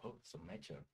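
whispered teasing "so mature"; mock whisper. Real, not acted. Streamer teases the guest's answer in a whisper. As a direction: breathe it out as a playful tease.

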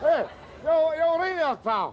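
loud, high call to a stranger: you all right there. Acted. Drunk man calls out to a stranger, loud and high. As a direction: hail him from across the way.

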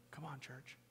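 ordinary talk

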